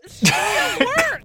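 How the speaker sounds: high, loud and slow; panicked outburst. Acted. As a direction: blurt it out in alarm. Player panicking in character.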